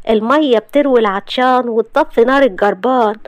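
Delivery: high, sarcastic wife ranting; mocking young wife. Wife mocking her husband's back-rub request, high and sarcastic. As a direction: roll your eyes and mock him in a high voice.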